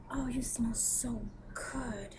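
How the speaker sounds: slow, high whispered come-on; imitating seductive whisper. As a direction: whisper it slowly like a client flirting. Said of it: "She's like".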